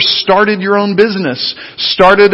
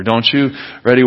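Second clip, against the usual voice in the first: ordinary talk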